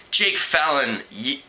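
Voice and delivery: usual speaking voice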